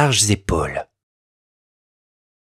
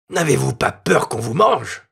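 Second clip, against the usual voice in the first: teasing soldier. A narrator's reading. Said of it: "lui dit-il".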